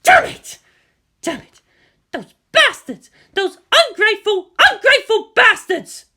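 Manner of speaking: loud, high, raging outburst; furious cursing. A narrator's reading. Misato cursing the Americans, loud and furious. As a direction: explode after slamming the desk. Misato slams fist, curses.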